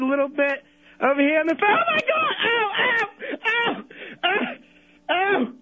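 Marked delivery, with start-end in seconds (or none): 1.7-5.5 high hammed-up ow ow ow; mock cries of pain. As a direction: cry out as if struck again, overplaying it. Caller hurt again on phone.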